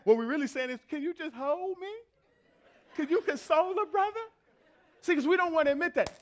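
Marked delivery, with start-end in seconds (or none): 0.9-4.2 high-pitched, hammed-up begging for comfort; mock vulnerable plea. Husband admitting he wants consoling, comic high plea. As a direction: plead needily, pitched well up. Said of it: "That's called vulnerability".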